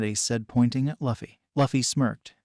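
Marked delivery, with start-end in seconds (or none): none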